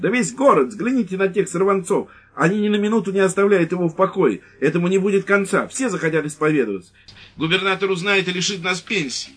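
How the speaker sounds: high, loud frantic rant; shrill ranting wife. Acted. Wife ranting at the lieutenant, high and loud. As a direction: rant on in alarm.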